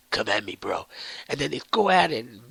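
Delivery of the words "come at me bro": much lower, gruff taunt; low Batman challenge. Retold or imitated. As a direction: drop low and dare him. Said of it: "Batman's like".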